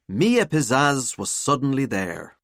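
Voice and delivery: ordinary delivery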